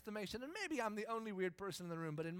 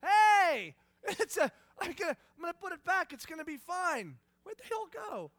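high, loud panicky yell; hammed-up frantic reassurance; calling after a fleeing crowd; 10 semitones higher, 13 dB louder than usual. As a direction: shout to bring them back.

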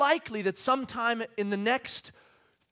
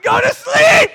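much higher, much louder yell; shouting; 18 dB louder, slower than usual. Retold or imitated. Father yelling at the restless toddler, loud shout. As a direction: bellow it at the toddler at 4am. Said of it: "And I said".

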